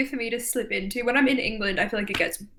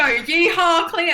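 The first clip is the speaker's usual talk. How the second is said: mock Texan; 6 semitones higher, 4 dB louder, much slower than usual. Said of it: "What do you want him to go?".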